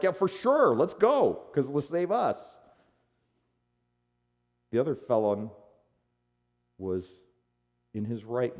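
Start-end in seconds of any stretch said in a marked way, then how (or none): 0.0-2.4 high, loud, eager egging-on; piling on. Acted. Felon joins everybody else mocking Jesus, loudly eager. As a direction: jump in loudly with the mockers. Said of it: "He's probably piling on".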